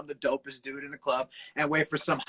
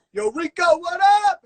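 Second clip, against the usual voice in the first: loud, higher friendly shout of a greeting; calling out; 11 semitones higher, 12 dB louder than usual. Retold or imitated. He recreates how his old friend Lauren greeted him, with a loud, high shout. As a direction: holler it like spotting a friend down the hall.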